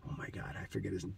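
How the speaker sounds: soft fast aside, barely voiced; a whispered aside while searching his memory; muttered to himself. Real, not acted. A hushed aside to himself while trying to recall Del's name.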